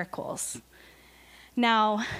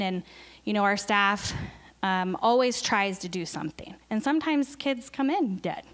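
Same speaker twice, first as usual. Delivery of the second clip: normal talking voice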